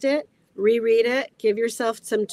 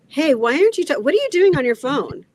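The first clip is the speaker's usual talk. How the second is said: high, loud, exasperated confronting about the phone; hammed-up jealous snap at a partner; a partner on the phone hears a mock exasperated accusation, much higher and louder; mock accusing partner; 10 semitones higher, 6 dB louder than usual. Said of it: "you don't want to come at it from there".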